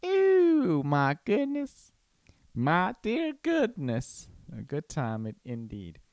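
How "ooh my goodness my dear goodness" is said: high, drawn-out mock delight; silly exclamation. Husband joking about having a good time, silly high voice. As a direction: stretch it out in a playful high voice.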